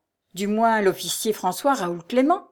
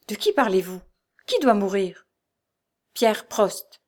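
ordinary delivery

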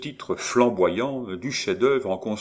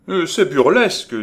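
high, loud scoff at the idea; scornful outburst; 10 semitones higher, 9 dB louder than usual. Acted. Maurice scoffing loudly at his co-author. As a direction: burst out mocking the play. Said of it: "dit Maurice".

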